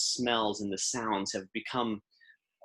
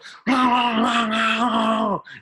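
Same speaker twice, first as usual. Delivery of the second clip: high loud mock roar at the cats; hammed-up beast roar; 12 semitones higher, 7 dB louder than usual. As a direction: roar to scare the caged cats. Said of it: "you lean down and go".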